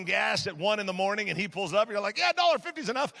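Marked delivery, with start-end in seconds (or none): 2.2-3.1 high, loud, nervous mock reply. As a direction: play a nervous customer, pitched way up. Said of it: "because you're nervous".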